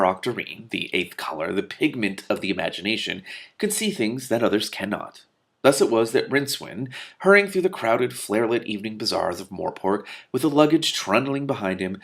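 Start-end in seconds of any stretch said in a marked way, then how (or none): none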